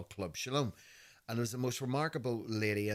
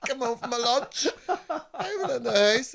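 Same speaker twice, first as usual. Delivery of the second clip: giggling through the words, high up; laughing it out as you tell it; 10 dB louder than usual. Real, not acted. Telling a friend about mum's move, laughing. Laughing while retelling coming home.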